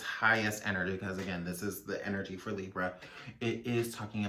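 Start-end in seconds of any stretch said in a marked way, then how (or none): none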